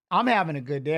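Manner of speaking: ordinary delivery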